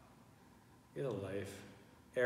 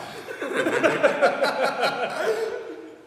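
high burst of laughter; loud laugh; 11 dB louder than usual. Real, not acted. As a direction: crack up hard. Laughing at the comment.

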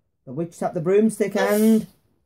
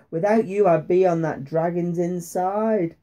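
ordinary delivery

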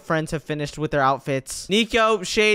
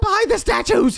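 man doing a woman's voice; 13 semitones higher, 3 dB louder than usual. Acted. Urgent hiding in girl voice.

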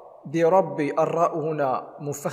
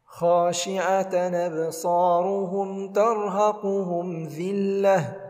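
slow, chanted tajweed recitation; measured tarteel chant; Quran recitation; 3 semitones higher, much slower than usual. Shown as a demonstration. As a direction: recite it melodically, drawing out the madd. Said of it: "خاشعة الخاء من حروف استعلاء".

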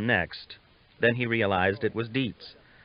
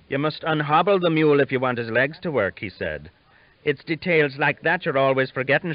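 higher, louder voice, amused big brother teasing; jolly Irish elder brother; 6 semitones higher, 6 dB louder than usual. Acted. Bald Alan chiding his brother Sean about the hobbled mule, amused. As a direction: tease your foolish brother kindly.

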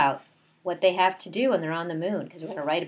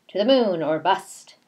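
slogan called out louder; proud slogan; 3 semitones higher, 8 dB louder, slower than usual. A narrator's reading. Bears proudly shouting their slogan as they fly. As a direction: cheer it out like a motto.

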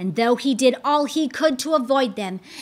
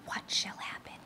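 hushed storyteller's whisper; suspense whisper; 15 dB quieter, faster than usual. A narrator's reading. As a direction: breathe the question to the listeners. Narrator's suspense aside.

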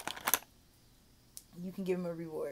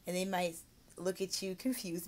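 usual speaking voice

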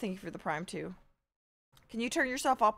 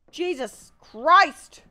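loud, high, very drawn-out oath; slow, raised-voice cry of frustration; exasperated shout; 5 semitones higher, 9 dB louder, much slower than usual. Real, not acted. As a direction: stretch each word out in loud exasperation. Exasperated outburst at the game.

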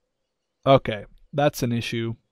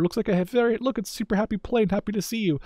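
higher-pitched, lightly voiced happy little greeting; happy plane; 7 semitones higher, faster than usual. Commentator cooing over the Sabre's front, playfully happy.

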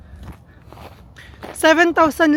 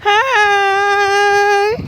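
calling out; 6 semitones higher, 7 dB louder than usual. Real, not acted. Greeting people from afar.

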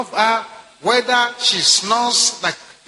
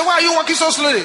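higher, quick impatient nag; nagging wife; 6 semitones higher, faster than usual. Retold or imitated. Preacher voicing a wife nagging her sick husband, higher and fast. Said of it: "Ah, you say".

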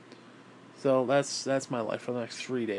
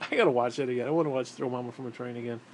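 ordinary talk, nothing marked

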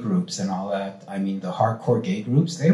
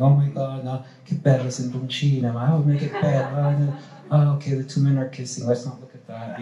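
imitating Italian nonnas; 4 semitones higher than usual. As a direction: ham up a shocked little Italian nonna. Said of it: "little nonnas coming into the play going".

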